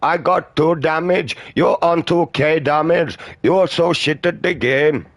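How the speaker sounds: mocking annoying player. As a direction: mimic the whiny online player rubbing it in. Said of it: "goddamn idiot ... on end of a fucking phone going".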